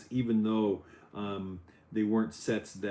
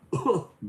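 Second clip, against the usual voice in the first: goofy laugh; 9 semitones higher, 3 dB louder than usual. Acted. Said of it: "that's my impression".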